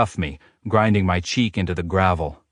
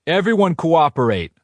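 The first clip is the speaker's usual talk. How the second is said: commanding shout, higher and louder; 9 semitones higher, 4 dB louder than usual. A narrator's reading. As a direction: bark the order to the crowd. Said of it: "Trey shouts".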